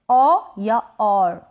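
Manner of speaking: "औ या और": पहले खुला ऑ, फिर ज़रा सा र; धीरे "औ या और", दोनों रूप अलग; हल्का र. Shown as a demonstration. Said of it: "र को बिल्कुल थोड़ा सा प्रोनाउंस". ("औ या और" में स्वर खींचें, र हल्का)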